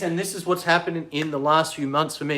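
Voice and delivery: normal talking voice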